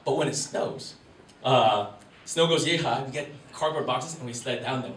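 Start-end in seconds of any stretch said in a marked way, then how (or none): none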